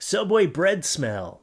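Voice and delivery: ordinary delivery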